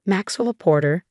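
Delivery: ordinary delivery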